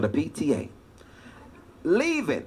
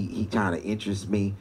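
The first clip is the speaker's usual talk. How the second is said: ordinary talk, nothing marked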